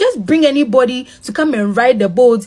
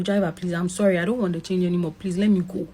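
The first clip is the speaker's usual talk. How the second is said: soft, pleading, lowered voice; begging softly; 4 semitones lower, 8 dB quieter, faster than usual. Retold or imitated. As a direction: plead quietly for your life. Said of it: "I was begging him".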